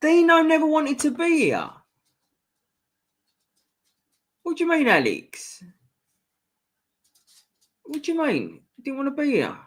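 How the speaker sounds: put-on high voice, teasing the viewers; high mocking voice. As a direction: squeak the lines out high and playful. High voice reading chat comments.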